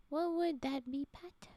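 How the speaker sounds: ordinary talk, nothing marked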